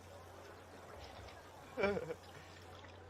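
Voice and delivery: a long burst of real laughter. Real, not acted. Laughing at his own story.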